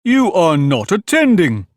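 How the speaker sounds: severe. A narrator's reading. Mouse catches Alice not attending, severe rebuke. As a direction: scold sternly, each word drawn out. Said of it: "said the mouse to Alice severely".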